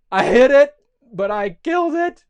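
high, loud panicked exclamation; acted panic. Johnny freaking out over the duck, high loud panic. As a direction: blurt it out in mock horror.

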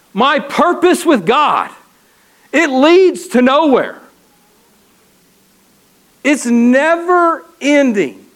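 ordinary delivery

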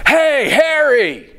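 high, long call across a ballpark; yelling a name. Retold or imitated. Fan shouting to a player at batting practice, drawn-out yell. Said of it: "Really loud, just yelling out onto the field".

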